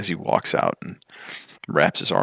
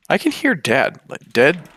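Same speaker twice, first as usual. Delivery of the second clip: excited; 9 semitones higher, 5 dB louder than usual. Acted. Hamish hears his father outside.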